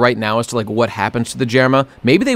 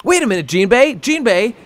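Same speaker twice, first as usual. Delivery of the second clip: high, hammed-up panicked questioning; goofy high-pitched disbelief; mock alarm; 5 semitones higher than usual. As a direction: pretend to freak out at Jinbei. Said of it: "I'm making a goof".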